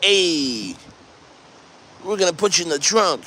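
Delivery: mock Italian mobster, long "Hey" then a quick threat; mafia Italian accent. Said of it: "the mafia Italian accent".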